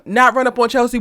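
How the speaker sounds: ordinary talk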